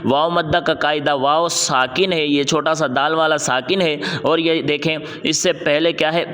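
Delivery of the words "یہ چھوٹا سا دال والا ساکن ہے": ordinary delivery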